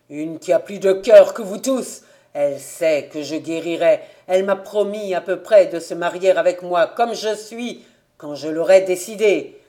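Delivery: loud, defiant boast; heated. Acted. Invalid son boasting to father of a bride, heated.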